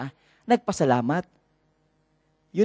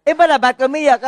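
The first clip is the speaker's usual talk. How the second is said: mock father; 8 semitones higher, 5 dB louder than usual. Father answers child in story.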